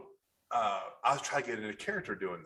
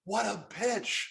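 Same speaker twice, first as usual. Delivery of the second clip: indignant; 7 semitones higher, much slower than usual. Retold or imitated. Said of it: "I was like".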